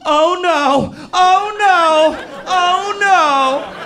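very high, strained "oh no" on repeat; a mock terrified squeal, repeated; panicked whimper. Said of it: "all I could mutter from my mouth was".

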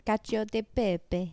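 "Cacio de pepe" with an exaggerated Italian accent; slow, hammed-up Italian "Cacio de pepe"; mock Italian accent. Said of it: "you know who I'm mocking".

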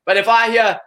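ordinary talk, nothing marked

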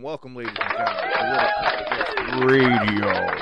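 a first try at the big show opener; casual radio announcer greeting; radio host intro. Shown as a demonstration. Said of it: "Wait, hold on, let me do it the right way".

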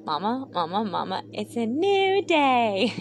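put-on high, loud, drawn-out child calling; little kid voice. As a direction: call out like a small child waking mom. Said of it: "and be like".

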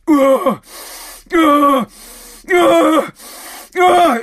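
gag on the smell; disgusted gagging. Retold or imitated. Gagging over the fishy pizza smell.

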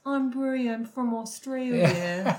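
slow, badly faked Australian introduction; drawn-out mock-Australian vowels; fake Australian accent. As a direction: imitate a contestant faking an Aussie accent. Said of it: "that contestant who faked her Australian accent".

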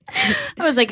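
ordinary delivery

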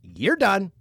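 punchy catchphrase shout; loud. Acted. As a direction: bark it out.

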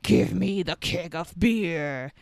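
a hint of a low, slow werewolf voice; mock werewolf growl. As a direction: lightly growl it out like a teen turning wolf. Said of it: "his voice deepens, very husky and grr, and he growls".

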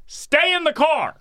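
high, drawn-out yell; long high-pitched order; yelling at comedians. Acted. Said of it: "I could yell at comedians".

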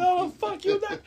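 very high comic squeal; mock outraged refusal. Patient refusing the nurse's help, squealed mock protest. Repeats refusal amid laughter.

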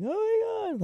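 hammed-up high, thin wail; mock crying. Denying she phoned in tears, exaggerated mock crying. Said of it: "I didn't call him crying like".

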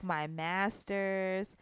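ordinary talk

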